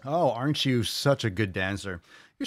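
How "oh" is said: ordinary delivery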